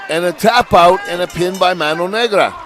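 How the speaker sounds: high, loud triumphant announcement; excited victory call. Real, not acted. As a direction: shout the pin like a ring announcer. Calling the winning pin.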